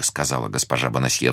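ordinary delivery, nothing marked

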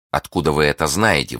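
usual speaking voice

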